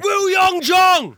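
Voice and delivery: very high, dragged out, loud; elated roar. Real, not acted. Gamer hailing his goalscorer, slow ecstatic yell. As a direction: stretch the name out in pure delight. Naming the winning scorer.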